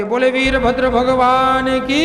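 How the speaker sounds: loud, drawn-out rallying cry; devotional acclamation call. Real, not acted. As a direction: call out slowly for the congregation to answer. Calls congregation to acclaim the deity. (ज़ोर से खिंचा हुआ जयकारा)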